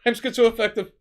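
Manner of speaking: loud, high declared excuse; mock yelling. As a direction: shout the diagnosis as an excuse, hammed-up. Co-host continues yelled excuses bit.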